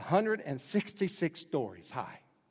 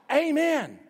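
much higher, loud amen; shouted amen; 12 semitones higher, 10 dB louder than usual. Retold or imitated. The people answer the reading with a shouted amen. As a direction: shout it out in worship. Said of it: "So they shout".

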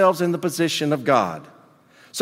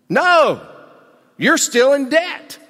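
emphatic no; 10 semitones higher, 5 dB louder, slower than usual. Acted. Emphatic answer to own question.

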